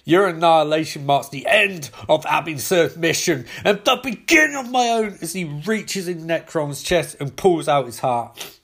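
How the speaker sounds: ordinary delivery, nothing marked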